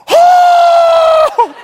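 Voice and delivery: long, loud falsetto "oh"; comic reenacted yelp of pain; high pained howl. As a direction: shriek it out in mock agony. Reenacts pain as stitch is jerked.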